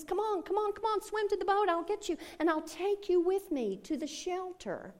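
high, urgent call from the boat; eager rescuer call. Acted. Rowboat man calling the stranded man to swim over, high and urgent.

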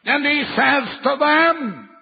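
normal talking voice

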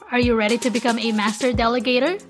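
ordinary talk

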